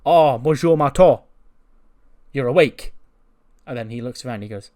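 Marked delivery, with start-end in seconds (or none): 0.1-2.9 louder, sing-song Bonjour; a hammed-up Frenchman greeting a waking man; mock French greeting. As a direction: greet him brightly with a hammed-up French air.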